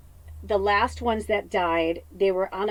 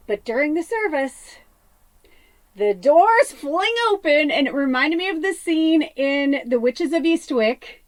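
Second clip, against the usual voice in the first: excited; 6 semitones higher, 6 dB louder than usual. Real, not acted. Excited storytelling, church scene.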